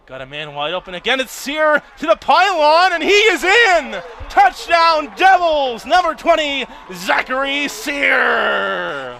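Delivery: high, loud, stretched-out touchdown shout; touchdown call. Real, not acted. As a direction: belt out the touchdown, slowing down. Touchdown called live.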